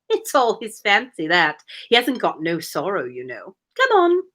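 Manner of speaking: a hint of a mock Cockney griffin; lightly voiced Cockney griffin, dismissive. Griffin brushing off the turtle's sorrow, mock Cockney. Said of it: "so I'm just doing a voice".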